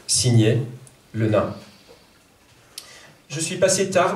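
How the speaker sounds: measured French poetry reading. Acted. Translator reads her poem to the audience, measured poetry reading. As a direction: read each line with weight.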